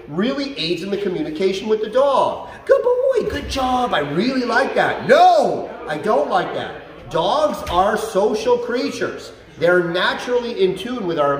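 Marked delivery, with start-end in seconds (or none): 2.7-5.1 much higher, upbeat praise that eases down; emotional praise. Shown as a demonstration. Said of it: "positive emotion or negative emotion". 5.1-7.1 loud, higher no, then slow I don't like that; displeased no. Shown as a demonstration. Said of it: "positive emotion or negative emotion".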